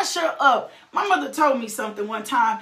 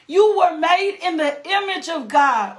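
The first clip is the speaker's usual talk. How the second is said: ordinary talk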